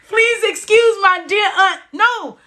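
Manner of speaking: high-pitched laughing through words. Real, not acted. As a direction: laugh while saying it, crying-laugh. Laughing while recalling mnemonic.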